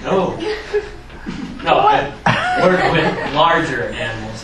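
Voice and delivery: laughing protest. Real, not acted. Student protests being called rat psychologist.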